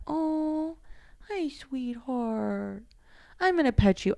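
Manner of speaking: cooing to the cat. Real, not acted. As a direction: melt into it for a pet. Greeting her cat.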